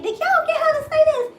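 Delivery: very high, loud; asking her other daughters, very high and astonished; amazed questioning. Retold or imitated. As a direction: demand an answer, astonished.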